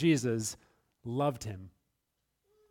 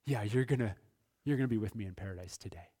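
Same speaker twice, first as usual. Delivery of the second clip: quick, offhand and softer reassurance; casual Jesus promise; 6 semitones lower, 5 dB quieter, faster than usual. Retold or imitated. Pastor voicing Jesus casually assuring the thief, quick and soft. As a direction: toss it off fast like a friendly nod. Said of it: "looked at him over here and said".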